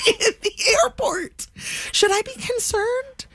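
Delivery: very high, squeaky with laughter; laughing incredulously. Real, not acted. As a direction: squeeze the words out through real laughter. Laughing helplessly at her own story.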